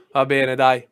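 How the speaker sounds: usual speaking voice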